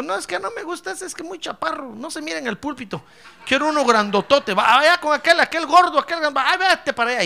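high, rising into a loud fussy whine; whiny complainer. Pretend. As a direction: sulk and demand a bigger pastor. Picky member complaining again.

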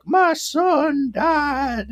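very high, very slow wailing line; exaggerated tearful mother voice; mock grieving mother. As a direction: stretch it out like a hammed-up grieving mom. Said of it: "the lady's like".

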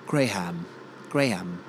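GRAHAM as "Gray-ham"; two even syllables, gray-ham, for Graham; English pronunciation. Shown as a demonstration. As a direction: sound the H in Graham. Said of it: "English say that one properly".

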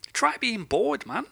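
shouted advice. Real, not acted. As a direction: yell it at them to try. Rant climax, shouting advice.

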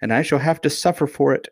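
high, plaintive self-pity; beginning to cry. A narrator's reading. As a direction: lament it as if tears are coming. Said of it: "beginning to cry again".

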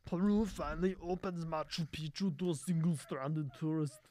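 a hint of an Austrian Schwarzenegger accent on "Peru finally opens Machu Picchu"; Arnold impression. Streamer reads news to viewers as Arnold, mild Austrian accent. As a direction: read the headline as a lightly voiced Arnold. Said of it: "It's so Arnold".

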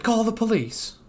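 high frantic cartoon voice; mock-panicked high plea; panicked cartoon cry. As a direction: shriek for help in mock panic. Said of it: "Squidward's melted".

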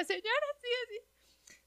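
high, choked crying voice; sobbing, pleading to his ex's mother; retold sobbing plea. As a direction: imitate yourself sobbing to her. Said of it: "Y le digo".

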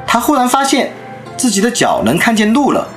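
ordinary delivery

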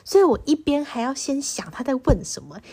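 ordinary talk, nothing marked

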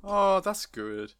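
high, slow, drawn-out appreciation; long, lifted sigh of approval; touched approval. Real, not acted. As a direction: coo it slowly, genuinely touched. Touched by the retold ending.

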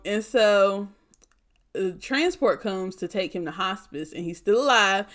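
ordinary talk

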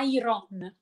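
IRON as "eye-ron", the R before a full O; wrong pronunciation. Shown as a demonstration. Teacher demonstrating the common mistake, IRON as "eye-ron". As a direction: mispronounce "iron" as "eye-RON", following the spelling. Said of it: "si legge in un altro modo".

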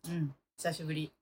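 stiff, flat line reading; monotone. Real, not acted. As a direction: say it like reading a script, no feeling. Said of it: "棒読みすぎ".

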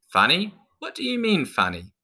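high-pitched, affronted questioning; indignant snap. A narrator's reading. Clown snaps at pirate over being called funny, indignant. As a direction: snap back as if insulted. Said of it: "Jane snapped".

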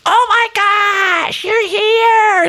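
very high, slow squealing voice; loud friend Beth. Retold or imitated. Husband imitating loud friend Beth greeting him. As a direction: shriek it out like an overexcited friend. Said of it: "She's like".

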